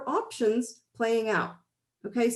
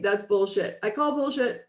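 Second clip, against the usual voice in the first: ordinary talk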